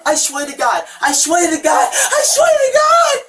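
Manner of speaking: rising panicked shouts; desperate pleading. Real, not acted. Suspect begging during arrest.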